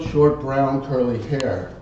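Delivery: usual speaking voice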